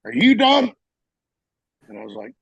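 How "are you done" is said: high, loud mock shout; imitated sergeant's demand. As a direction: demand it loudly like an impatient sergeant. Gunny's retold impatient challenge.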